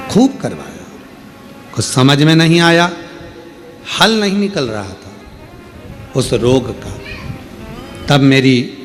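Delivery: ordinary talk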